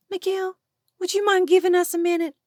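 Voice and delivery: high, squeaky and fast; nervous squeak, pushed up high. Acted. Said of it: "Julie asked in a squeaky voice".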